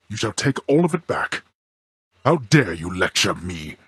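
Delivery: how dare you, slowed and stern; angry rebuke. Acted. Father rebuking his son for lecturing him, indignant and stern.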